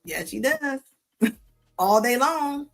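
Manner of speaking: normal talking voice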